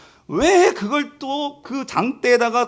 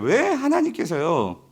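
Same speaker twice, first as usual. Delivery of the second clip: ordinary talk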